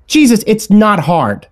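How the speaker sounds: high, slow, emphatic scolding; exasperated mother. A narrator's reading. Mother fed up with child's headshake, exasperated. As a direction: drag it out in exasperation.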